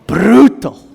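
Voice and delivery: "Brutal" with a rolled Afrikaans r; Afrikaans accent. Shown as a demonstration. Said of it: "chose the word brutal because of my Afrikaans accent".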